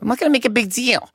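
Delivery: high, quick mock protest; protesting mom. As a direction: imitate mom insisting, voice shooting up. Mom's protest in retold argument.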